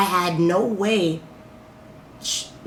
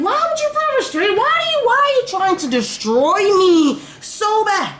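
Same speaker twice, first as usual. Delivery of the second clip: very high, wailing reproach; high-pitched, distraught demand for answers; anguished outburst; 13 semitones higher, 3 dB louder than usual. Retold or imitated. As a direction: plead and accuse at the top of your range. Acting out anguished questions to accuser.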